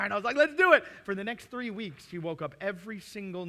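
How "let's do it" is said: high, loud eager cheer; excited. Retold or imitated. Dad cheering his little daughter on for the movie, excited. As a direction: fire up for movie night.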